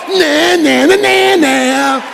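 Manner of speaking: mocking taunt. Shown as a demonstration. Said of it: "And you can go".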